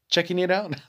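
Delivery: a real chuckle breaking through the words; laughing at himself. Real, not acted. Host laughing at his own stumbling words. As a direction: giggle through the last repeat.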